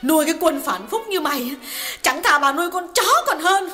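high female voice, lightly resentful; a hint of a wronged wife's bitterness; bitter indignation. A narrator's reading. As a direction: spit out the grudge. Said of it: "tiếng Hà lại uất ức vang lên".

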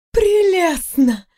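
высокий, протяжный восторг русалки; восхитись звонко и нараспев; радостная ундина. A narrator's reading. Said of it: "Ундина радостно захлопала в ладоши". (восторженный возглас водяной девы, слово врастяжку)

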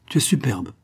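ordinary delivery

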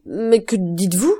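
slow, horrified protest; shocked exclamation. A narrator's reading. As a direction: cry out in disbelief, stretching the words. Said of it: "s'écria madame de Villefort".

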